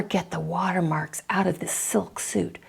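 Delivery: voiceless whisper of private dismay; inner thought whisper. Retold or imitated. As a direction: breathe it out as a secret worry. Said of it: "the first thought that goes through your mind".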